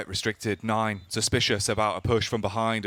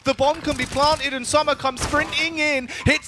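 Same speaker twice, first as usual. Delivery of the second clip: rising, louder hype call; excited caster; 13 semitones higher, 4 dB louder than usual. Real, not acted. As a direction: build the excitement through the play. Building play-by-play.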